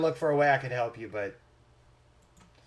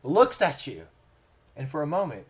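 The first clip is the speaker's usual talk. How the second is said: raised, building storytelling with pauses; dramatic game master narration; 3 semitones higher, 4 dB louder than usual. A narrator's reading. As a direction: narrate a mock tragedy, swelling to the end. Game master narrates the deer's death.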